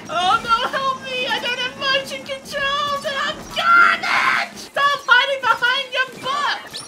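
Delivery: high panicked gamer shout; comic panic. Real, not acted. Player stuck without controls begging for help, comic panic. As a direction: yell for help in a fluster.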